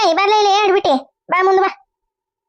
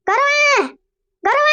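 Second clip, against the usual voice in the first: shouting a name; 9 semitones higher, much slower than usual. Acted. Shouting for Gaurava.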